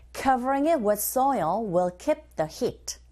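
slow, careful drill reading; teacher modelling the phrase slowly; slow model line. Shown as a demonstration. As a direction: say it slowly for students to copy. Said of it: "Give a shot in the sentence".

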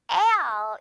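L drawn out as a long "ae-al"; rubber-band stretch. Shown as a demonstration. Teacher demonstrating the sound, L long and drawn out. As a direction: stretch L open like elastic. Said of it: "고무줄처럼 늘어뜨리는".

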